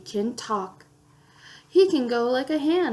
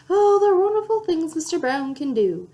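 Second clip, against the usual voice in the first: usual speaking voice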